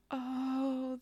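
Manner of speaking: ordinary delivery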